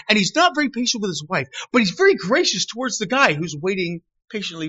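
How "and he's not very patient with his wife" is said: ordinary talk, nothing marked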